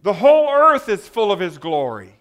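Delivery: loud, ringing proclamation; crying out. Acted. Preacher finishes the seraphim's cry of praise, loud proclamation. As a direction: proclaim it at full voice, falling at the end. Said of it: "these creatures cry out".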